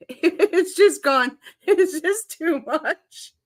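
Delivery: can't stop laughing; wheezing laughter. Real, not acted. Streamer losing it over the scratch and sniff remark, laughing hard.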